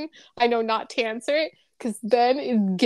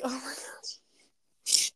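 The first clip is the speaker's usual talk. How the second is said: hushed, slow whisper; whispered aside; 7 dB quieter, much slower than usual. Retold or imitated. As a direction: whisper it so the movie isn't disturbed. Retold annoyed aside during movie.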